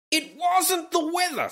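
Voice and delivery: high-pitched, hammed-up propaganda voice; mock North Korean newspaper. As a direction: declaim it high, as a mock state rebuttal. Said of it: "so the newspaper has called him a coward".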